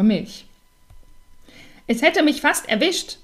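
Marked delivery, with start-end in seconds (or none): 1.9-3.2 much higher scolding squawk; bird shrieking. A narrator's reading. Little bird scolding after nearly being hit, much higher. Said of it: "keifte der kleine Vogel".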